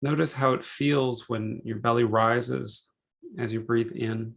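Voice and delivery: unhurried meditation guide, measured phrases with long pauses; calm, spacious relaxation-session delivery; guided meditation. Real, not acted.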